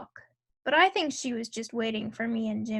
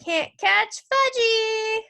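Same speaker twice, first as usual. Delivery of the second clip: high, loud, drawn-out taunt; singsong little-kid yell; hollering toddler; 9 semitones higher, 5 dB louder, much slower than usual. A narrator's reading. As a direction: holler it teasingly like a small boy running off. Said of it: "he hollered".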